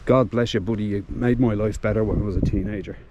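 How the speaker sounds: ordinary talk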